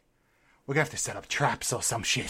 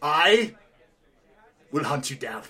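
Taken impression; slower than usual. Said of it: "you're doing Taken".

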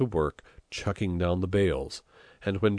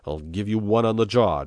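snapped threat; 3 semitones higher, 7 dB louder than usual. A narrator's reading. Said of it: "turned on him in a flash".

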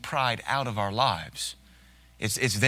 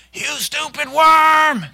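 barked drill-sergeant insult, much higher and much louder; 12 dB louder, much slower than usual. Retold or imitated. Pastor mocking how a drill sergeant yells at cadets, furious bark. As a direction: scream it slowly like a Marine drill instructor. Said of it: "like you do a cadet in the Marines".

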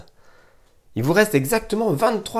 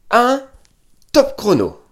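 départ annoncé fort; 7 semitones higher, 6 dB louder, slower than usual. Real, not acted. Coach lance le chrono.

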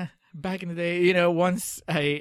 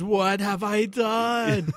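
slow, drawn-out anguished wail, higher; anguished cry; 6 semitones higher, 3 dB louder, much slower than usual. Retold or imitated. Falling to his knees in the mud, slow anguished wail. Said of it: "Falls to his knees in the mud and goes".

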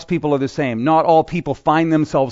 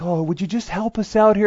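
good cop; 5 semitones higher than usual. Retold or imitated. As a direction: plead sweetly like the nice cop. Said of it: "the good cop is like".

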